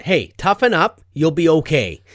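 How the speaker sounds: imitated no-nonsense parent; brisk brush-off. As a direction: buck a kid up loudly. Brushing off a hurt child.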